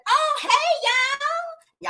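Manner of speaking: impersonating a friend's breezy hello, high and slow; Mel's voice. Retold or imitated. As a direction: stretch every word like a diva arriving. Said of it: "I gotta say it in her voice".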